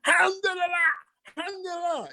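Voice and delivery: high, altered voice praising God; imitated foreign voice giving thanks; imitating a joyful believer. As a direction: exclaim it like an overjoyed pilgrim. Voicing the rabbi rejoicing.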